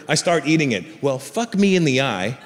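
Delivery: ordinary talk, nothing marked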